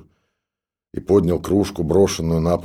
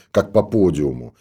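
ordinary delivery

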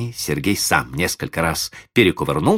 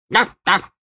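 high dog bark. A narrator's reading. Dog barking twice.

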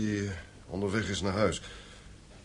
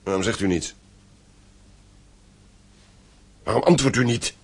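growing urgency; 4 semitones higher, 5 dB louder than usual. Acted. Father pressing the silent innkeeper.

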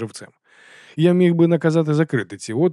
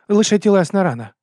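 high, louder bot voice; plucky little bot; 8 semitones higher, 6 dB louder than usual. A narrator's reading. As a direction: pipe up to play down the injury. Bot Miki makes light of injury.

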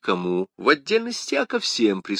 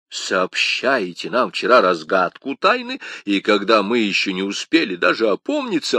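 usual speaking voice

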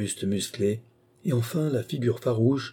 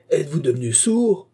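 higher, irritated question; angry cry; 7 semitones higher, 4 dB louder than usual. A narrator's reading. Aviator to the silent worker, crying out in anger. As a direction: call out crossly. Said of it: "L'aviateur se mit en colère".

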